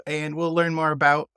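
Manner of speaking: ordinary talk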